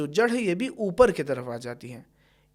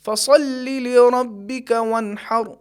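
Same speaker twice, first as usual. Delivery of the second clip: slow, melodic tilawat, higher and louder; Quran recitation; 5 semitones higher, 6 dB louder, much slower than usual. Acted. Teacher reciting Surah al-Kawthar as tilawat. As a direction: chant it slowly like a Qari.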